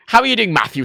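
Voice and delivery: high, fully worded English "How are you"; telling-off English. Retold or imitated. English speaker seeming to tell him off, stern English. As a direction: greet in English as if scolding. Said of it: "sounds like I'm being told off".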